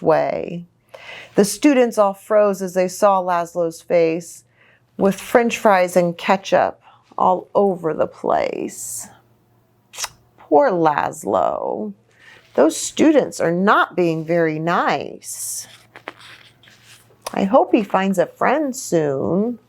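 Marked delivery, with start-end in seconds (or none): none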